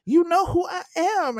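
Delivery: high, loud, haughty mock self-importance; entitled socialite. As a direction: play the spoiled heiress name-dropping herself. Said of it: "Miss Lady was like".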